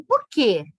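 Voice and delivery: "por quê?" with a loud, stressed "quê"; a stressed "quê" ending the question; stronger intonation on quê. Shown as a demonstration. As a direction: hit "quê" with force. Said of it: "uma entonação mais forte".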